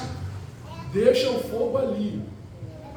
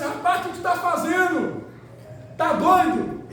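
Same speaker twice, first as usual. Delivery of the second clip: high, incredulous mock scolding; alarmed onlooker; 8 semitones higher than usual. Onlooker to friend dousing the barbecue, high incredulous outcry. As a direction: act out disbelief, pitched well up.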